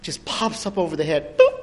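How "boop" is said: short, loud, high-pitched boop; cartoonish pop-up sound; high antenna boop. Imitates antenna popping up.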